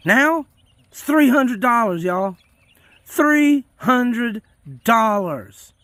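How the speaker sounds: high, loud indignant outburst; exasperated shout about prices; ranting. Real, not acted. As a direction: hammer home the outrageous price. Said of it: "Sorry I'm ranting".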